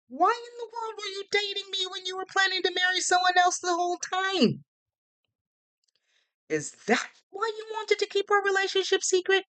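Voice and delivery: very high-pitched, indignant outburst; voice up an octave. A narrator's reading. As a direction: jump up an octave in outraged disbelief. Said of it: "My voice went up an octave".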